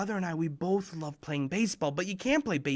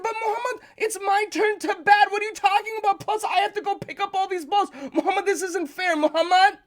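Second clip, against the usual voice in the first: much higher, loud whining complaint; whiny pleading; 7 dB louder than usual. Retold or imitated. Little brother begs for his turn to bat, high and whiny. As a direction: whine that it isn't fair.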